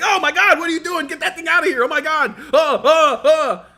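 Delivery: high, loud, panicked stammer; a teacher recoiling from a bat; scared teacher. Retold or imitated. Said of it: "He s-, got scared".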